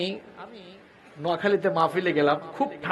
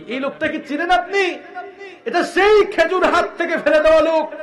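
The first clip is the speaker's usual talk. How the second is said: excited; 10 semitones higher, 6 dB louder than usual. Acted. Companions excitedly ask the Prophet.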